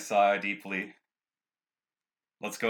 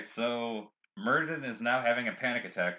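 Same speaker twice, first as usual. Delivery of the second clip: ordinary talk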